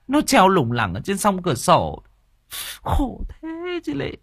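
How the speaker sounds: high-pitched, plaintive mother's voice; tearful old mother. A narrator's reading. Mother telling the visitor about her son's hanging, tearful and plaintive. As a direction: pour it out in a high wail. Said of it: "Bà Nụ mếu máo".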